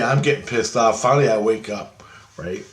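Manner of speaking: ordinary talk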